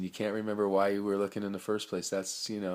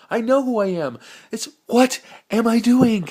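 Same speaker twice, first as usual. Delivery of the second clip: much higher and louder, a manic cry; excited yell; 13 dB louder than usual. Real, not acted. Crying out the question about himself, high and loud. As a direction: burst out with it.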